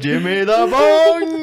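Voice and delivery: very high, loud, slow hollered nickname; shouted nickname. Retold or imitated. Boss spotting the worker downtown, slow high holler. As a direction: bellow it across the street. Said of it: "he'd be like".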